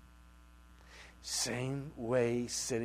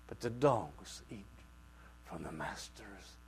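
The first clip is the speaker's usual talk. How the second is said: ordinary talk, nothing marked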